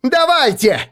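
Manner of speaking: high, loud defiant yell. A narrator's reading. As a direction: dare them to come. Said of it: "заорала она".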